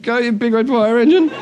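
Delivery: hesitant, questioning echo; tentative rising chant; unsure crowd. Acted. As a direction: repeat it as a puzzled question. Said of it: "looked at each other and went".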